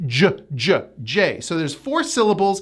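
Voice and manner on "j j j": "J" voiced as a low juh, repeated; J sound. Shown as a demonstration. Teacher shows the D's sound, low repeated "J". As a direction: isolate the "J" sound, juh, juh, then its name. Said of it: "We pronounce the D as a J".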